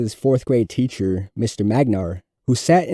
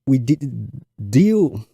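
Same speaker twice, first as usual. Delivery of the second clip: heavy stutter. A narrator's reading. Nervous fourth grader stuttering through his book report to class. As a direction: fight through each repeated sound. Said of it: "relieved that his presentation was over".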